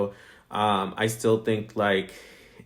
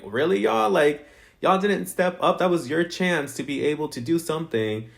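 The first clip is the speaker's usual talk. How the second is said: incredulous reaction; 7 semitones higher than usual. Retold or imitated. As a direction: call them out in raised-pitch disbelief, easing off toward the end. Acted incredulous reaction to the contestants.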